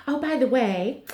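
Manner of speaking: lightly voiced Heather catchphrase; tossing off the catchphrase in a light impression; Heather's catchphrase. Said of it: "Heather with her".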